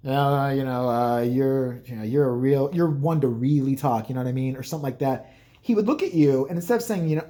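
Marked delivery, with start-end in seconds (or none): 0.1-4.6 low, hesitant put-on voice; imitated speaker addressing dad. Someone making a remark to dad, low and halting. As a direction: mumble it low, feeling for the words. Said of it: "said something to my dad like".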